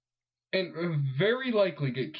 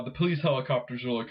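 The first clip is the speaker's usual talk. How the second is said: usual speaking voice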